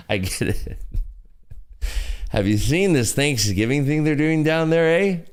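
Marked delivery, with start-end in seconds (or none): 2.3-5.2 high, hammed-up mock Canadian; put-on Canadian with a lifted "eh"; bad Canadian accent. Streamer imitating a Canadian gossiping about Thanksgiving, bad Canadian accent. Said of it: "has a Canadian accent".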